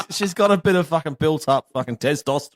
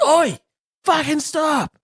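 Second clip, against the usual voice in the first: sharp, higher shout of Oi, stop; retold shout; 14 semitones higher, much slower than usual. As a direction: yell at him to stop, a step up in pitch. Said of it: "I pulled up and said".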